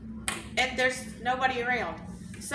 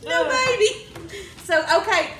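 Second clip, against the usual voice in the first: laughing; 10 semitones higher, 7 dB louder than usual. Real, not acted. Laughing at own story.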